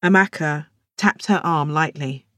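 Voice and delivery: plain reading voice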